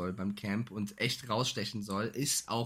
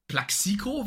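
incredulous rising Plaxico; puzzled, high and loud; baffled question; 8 semitones higher, 4 dB louder, slower than usual. Retold or imitated. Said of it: "dachte, Alter".

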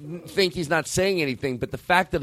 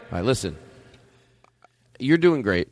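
ordinary talk

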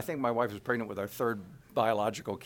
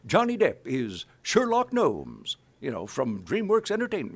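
movie trailer voice; 4 semitones higher, 5 dB louder than usual. Shown as a demonstration. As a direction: announce it like a coming-attractions spot. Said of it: "movie trailers".

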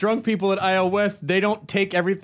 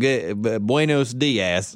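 low, very slow Southern drawl in Spanish; mock American Southern Spanish; Southern-accented Spanish; 8 semitones lower, much slower than usual. Shown as a demonstration. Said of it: "it's that southern, that southern person".

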